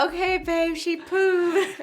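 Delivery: high, loud singled-out call, laughing; calling to partner. Retold or imitated. Wife summoning husband for a dirty diaper, mock calling out. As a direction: playfully holler for help, voice way up.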